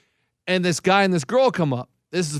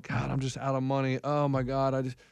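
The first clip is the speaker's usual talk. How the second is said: mock despairing mutter; 5 semitones lower, 10 dB quieter than usual. Broke gambler voiced in story.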